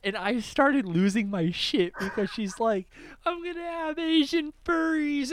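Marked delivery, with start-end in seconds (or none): 3.2-5.3 high-pitched imitation of a crying friend; mock tearful. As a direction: mimic her tearful wail, pitched way up. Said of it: "tears streaming down her face".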